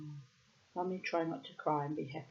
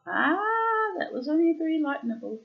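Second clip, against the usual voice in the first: startled; 9 semitones higher, 8 dB louder than usual. Real, not acted. Bird nibbles her.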